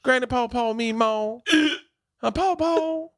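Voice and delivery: exaggerated Deep South twang; Deep South accent. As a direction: mimic Southern family calling granny. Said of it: "around her in Alabama or in Georgia".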